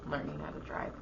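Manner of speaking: hushed aside to the mic; whispering. Acted. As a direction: drop to a whisper for the dig. Mock advice to bad driver.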